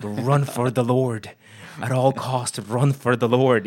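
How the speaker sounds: loud, slow, hammed-up German accent preaching; Reinhard Bonnke German accent. Guest retelling Bonnke's advice, loud hammed-up German accent. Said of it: "Probably a horrible accent that I just did".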